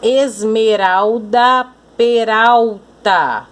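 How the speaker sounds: esmeralda and peralta slow and drawn out; rhyme demonstration. Shown as a demonstration. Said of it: "uma cor diferente pra cada rima".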